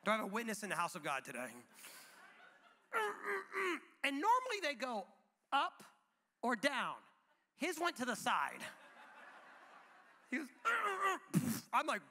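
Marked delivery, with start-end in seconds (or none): 2.9-3.8 high-pitched strained grunts; imitated toddler straining. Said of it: "he's making these noises". 10.5-11.6 high-pitched strained grunts; imitated toddler straining. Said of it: "he's making these noises".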